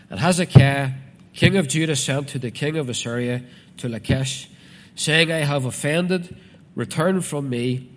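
declaimed scripture, names punched out; scripture reading. Acted. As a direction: proclaim the verse to the congregation. Reads scripture aloud to congregation.

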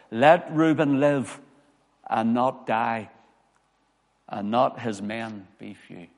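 slow, loud, solemn reading of scripture; grave pulpit reading. Acted. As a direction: proclaim the verse slowly from the pulpit. Preacher reads scripture aloud.

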